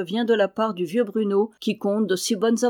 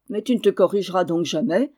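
ordinary delivery, nothing marked

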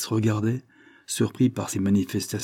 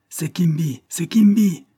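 high exclamation building louder; excited cry; 9 semitones higher, 6 dB louder, slower than usual. A narrator's reading. Man hearing voices outside, crying out a name. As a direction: cry out, higher on the repeat. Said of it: "s'écria Hammersmith".

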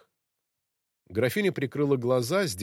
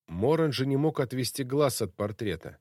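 plain reading voice

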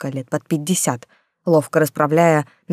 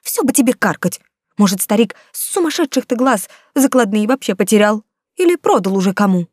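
scolding, pitched high and louder; cross, raised-voice protest; angry; 6 semitones higher, 4 dB louder than usual. A narrator's reading. Skinny woman arguing back about the lost pledges, angry. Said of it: "рассердилась худая".

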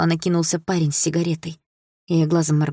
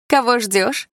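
smirking question; 7 semitones higher, slower than usual. Acted. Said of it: "усмехнулась Малина".

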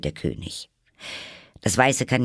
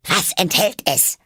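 imperious queen; 12 semitones higher, 5 dB louder than usual. Acted. Queen demands.

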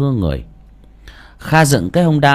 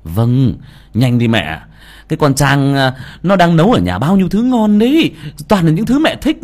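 pleading; 5 semitones higher, 4 dB louder than usual. Acted. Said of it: "khẩn khoản".

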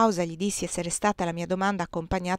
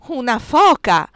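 triumphant exclamation; 4 semitones higher, 9 dB louder, slower than usual. Acted. Bursar triumphant.

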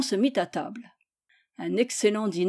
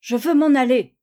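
higher, louder acted shout; impatient cry; 4 semitones higher, 7 dB louder than usual. A narrator's reading. Gisèle tries to escape the pestering children, impatient cry. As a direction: cry out to be left alone. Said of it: "cria Gisèle".

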